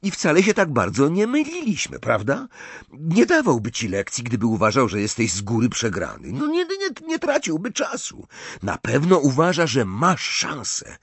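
animated, stumbling over words in excitement; excited. A narrator's reading. Ron excitedly assures Harry that he stands a chance. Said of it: "rzekł podniecony Ron".

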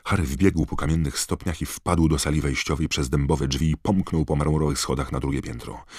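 ordinary delivery, nothing marked

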